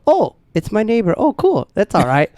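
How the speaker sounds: bright jump up to a friendly "oh, cool"; anger flips into delight when he spots the neighbor; sudden cheerful relief. Retold or imitated. Sees it's his neighbor.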